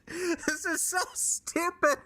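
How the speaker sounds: high-pitched, slow laughing disbelief. Real, not acted. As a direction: laugh through it, voice way up. Repeats the complaint, laughing.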